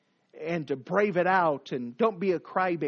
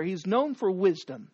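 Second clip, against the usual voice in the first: normal talking voice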